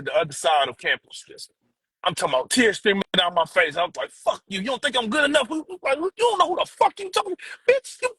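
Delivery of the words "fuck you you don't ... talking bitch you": retold tearful anger. Player running off in tears, answering his coach, retold high angry outburst. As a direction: voice climbing very high in reenacted outrage. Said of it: "I'm crying, I'm angry".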